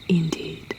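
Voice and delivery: plain reading voice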